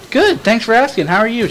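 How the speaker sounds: over-nice customer act; mock polite. To the Walmart cashier, in fake-sweet politeness.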